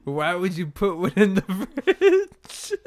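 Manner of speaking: high, loud, slow laughing question; incredulous laughing question; laughing disbelief. Real, not acted. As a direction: ask it cracking up.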